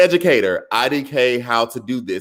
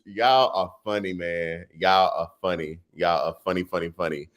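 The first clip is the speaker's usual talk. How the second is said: ordinary talk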